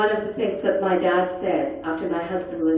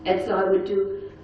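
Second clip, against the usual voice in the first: usual speaking voice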